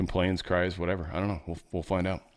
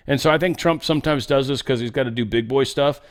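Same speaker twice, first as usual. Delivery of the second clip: ordinary talk, nothing marked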